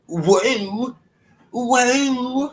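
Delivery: high, rising, drawn-out whoa; surprised whoa. Real, not acted. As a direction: pitch it up in surprise each time. Reacting to donations on stream.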